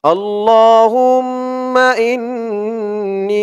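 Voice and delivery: slow, drawn-out recited Arabic; model recitation. Shown as a demonstration. Teacher models the word for viewers to repeat, slow recitation. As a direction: stretch every syllable for them to copy. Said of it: "আগে ধরুন আরবিটা".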